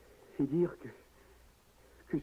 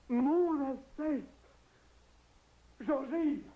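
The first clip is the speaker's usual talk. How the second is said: much higher and louder, desperate calls; frantic shouting; 14 semitones higher, 4 dB louder, much slower than usual. Acted. Husband cries out for the servant as his wife dies, frantic shouting. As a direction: shout for help in panic. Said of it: "Ne crie pas si fort".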